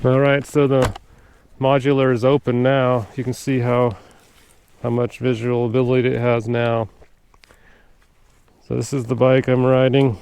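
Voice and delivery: muffled, heard through a helmet; recorded through a helmet mic. Real, not acted. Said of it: "so it's still recording through the, mic inside the helmet".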